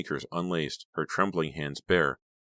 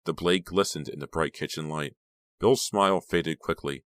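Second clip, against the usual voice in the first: plain reading voice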